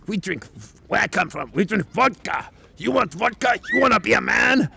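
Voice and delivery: loud, high, mock-macho Russian challenge. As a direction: build to a shouted dare in a put-on Russian accent. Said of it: "stereotypically Russian guy".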